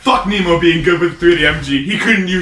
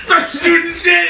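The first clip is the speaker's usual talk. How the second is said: usual speaking voice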